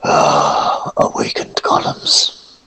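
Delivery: breathy, half-whispered complaint; weary exasperation. Acted. Figure lamenting awakened golems, weary and whispery. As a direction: sigh the words out slowly.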